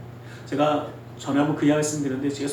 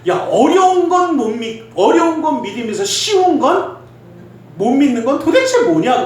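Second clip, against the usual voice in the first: high, loud incredulous challenge; imitated young friend; 9 semitones higher, 5 dB louder than usual. Preacher re-enacts a child challenging a doubting friend, high loud imitation. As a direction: throw it out like a kid challenging a friend, pitched high. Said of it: "제가 그대로 할게요".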